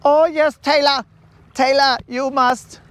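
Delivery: put-on high-pitched, insistent wedding planner accent; hammed-up accented planner, urging; put-on high foreign voice; Franz.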